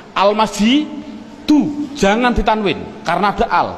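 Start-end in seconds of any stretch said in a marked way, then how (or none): 0.1-1.9 "Al-masjidu" drawn out, ending in u; rofa ending. Shown as a demonstration. Teacher demonstrates the word with al, rofa ending -du. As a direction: slowly sound out "Al-masjidu" with a final -du, no n. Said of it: "Coba rofaknya bunyinya bagaimana".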